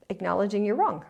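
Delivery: ordinary delivery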